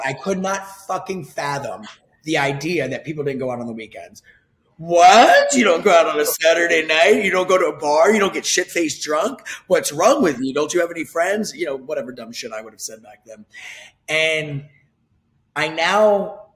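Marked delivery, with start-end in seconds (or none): 4.8-11.5 loud, high, hammed-up shock at staying in; mock outraged disbelief. Younger self scolding older friend, loud mock outrage. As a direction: play his young clubbing self, loud and appalled.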